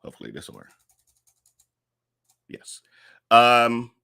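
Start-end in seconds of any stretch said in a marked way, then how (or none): 0.0-2.8 very low, barely voiced aside; muttered to self. Real, not acted. Host tests the new poll for viewers, very quiet mutter. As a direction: think aloud softly while you check.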